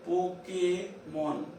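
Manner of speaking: "Pokémon" drawn out in pieces; dictation-slow "Po-ké-mon"; slow syllables. Shown as a demonstration. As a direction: say "Pokémon" slowly, pausing before "mon". Teacher repeats example slowly.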